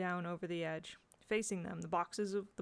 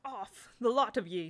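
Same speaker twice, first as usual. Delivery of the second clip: snapped; 2 semitones higher, 4 dB louder than usual. A narrator's reading. Boy to the children in a rage, snapped. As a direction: order them away with abrupt anger. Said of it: "he snapped".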